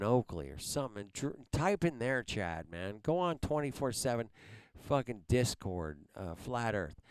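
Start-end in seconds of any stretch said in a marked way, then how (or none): none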